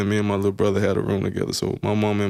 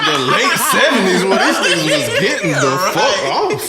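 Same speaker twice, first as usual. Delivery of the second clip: laughing loud; 8 semitones higher, 4 dB louder than usual. Real, not acted. Joking about parents' era.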